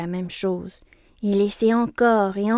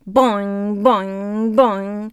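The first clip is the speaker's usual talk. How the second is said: bouncy boing sounds; 6 dB louder, much slower than usual. Acted. Toy imitates bouncing.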